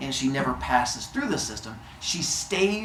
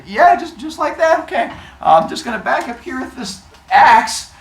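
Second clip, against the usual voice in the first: high, louder, overly sweet reassurance; sneaky reassurance; 11 semitones higher, 9 dB louder than usual. Retold or imitated. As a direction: coax him sweetly while sneaking back. Hephaestus readying axe.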